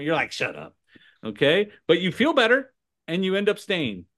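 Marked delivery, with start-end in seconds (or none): 0.3-0.8 low, softer dismissal; muttered retort. Acted. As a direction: drop right down for a dry retort. Retort to gushing agent.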